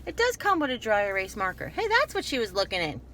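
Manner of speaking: ordinary talk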